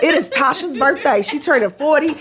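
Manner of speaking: high, pleading, laughing protest; protesting high-pitched. Retold or imitated. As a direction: squeak out the excuses like a panicked patient. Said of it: "And I'm like".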